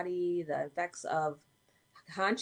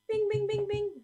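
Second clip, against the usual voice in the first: high bright bing bing, like a bell; mock phone ringer; 5 dB louder than usual. Calling for her missing phone, mimicking a ringer. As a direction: play the ringer with your voice, much higher.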